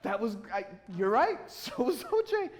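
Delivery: high-pitched, laughing retold repeat of the jab; amused concession. Applicant conceding to the interviewer's jab, laughing. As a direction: give in and crack up on the punchline. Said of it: "that's what I did".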